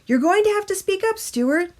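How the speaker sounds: a hint of a teacher losing patience; teacher telling Stuart to speak up, brisk. A narrator's reading.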